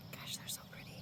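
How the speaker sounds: soft breathy whisper over the flowers. Real, not acted. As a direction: hush it, almost no voice, admiring. Close-up of flowers, hushed.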